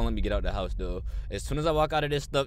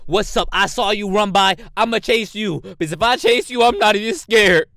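loud taunting; 9 semitones higher, 10 dB louder than usual. Real, not acted. Taunting the monster loudly.

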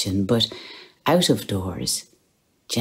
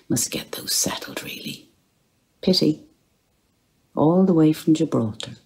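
ordinary delivery, nothing marked

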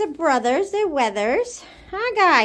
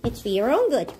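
ordinary delivery

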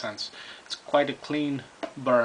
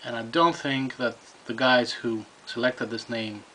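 ordinary talk, nothing marked

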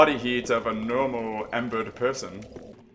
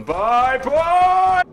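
high, loud, escalating goodbye yell; fleeing shout; 8 dB louder than usual. Real, not acted. Player fleeing two aggroed enemies, high panicked shout. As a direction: shriek bye as you run off.

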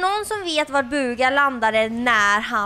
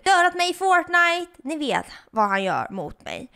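normal talking voice

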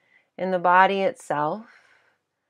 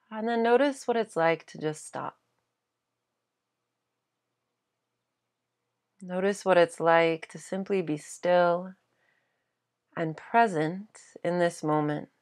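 guided meditation. Acted. Teacher guiding listeners to simply stop and be present, guided meditation. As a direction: guide them gently into stillness, leaving space between phrases.

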